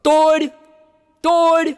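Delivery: furious shout. Retold or imitated. Homeowner with a gun yelling at the burglar. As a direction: bellow it at the thief.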